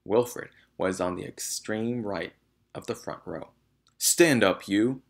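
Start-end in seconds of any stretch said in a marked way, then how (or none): 4.0-5.0 loud commanding shout. A narrator's reading. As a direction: order him up, loudly. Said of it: "she shouted at him".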